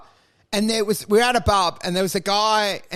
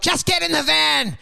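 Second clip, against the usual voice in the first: high-pitched mock yell; retold yell; 6 semitones higher than usual. Man returning to demand they board, mock yell. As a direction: yell the order as the man would. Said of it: "He came back and yelled".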